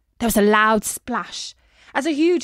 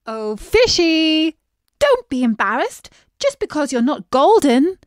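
coaxing call; 4 semitones higher, 6 dB louder than usual. A narrator's reading. Princess coaxing the shy fish out. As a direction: coax gently, like calling a shy pet. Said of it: "said Beatrice".

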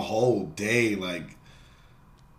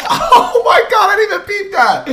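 laughing shout; 8 dB louder than usual. Real, not acted. Streamer reacting to a classmate getting hit, laughing shout. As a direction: crack up and shout it.

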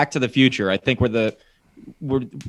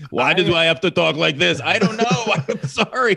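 mock Terminator accent; 5 semitones higher than usual. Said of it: "He'd be like".

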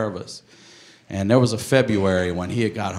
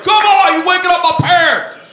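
high, loud outraged shout; shouted, fed-up complaint; angry yelling; 9 dB louder than usual. Acted. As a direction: yell like a woken-up neighbour. Acts neighbour woken by horn.

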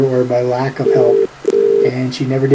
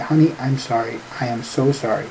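soft apology; 8 dB quieter than usual. Retold or imitated. Retold apology to angry wife.

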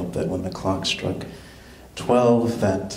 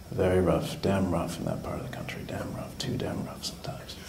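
fading softer, as if choking up; mock tearful; 9 dB quieter than usual. Comic recalls his rough school days, mock tearful. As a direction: let it trail away to a near whisper, hammed-up. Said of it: "Tear just might come to my eye".

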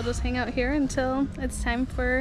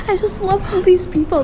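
higher, louder, laughing through it; laughing and gushing; 7 semitones higher, 9 dB louder than usual. Real, not acted. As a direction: gush about the people through laughter. Gushing about the staff.

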